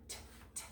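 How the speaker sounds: "T" as a soft voiceless tap, twice; letter sound. Shown as a demonstration. Teacher showing the T sound, "T" as voiceless "t". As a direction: tick "T" quietly as a bare consonant. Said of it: "Make the T sound".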